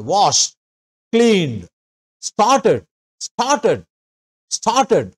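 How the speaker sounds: Wash and cleaned stressed on the first part; corrected readings; stress at the front. Shown as a demonstration. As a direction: model word list, stress up front. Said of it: "ఆ word ముందు stress ఇవ్వండి".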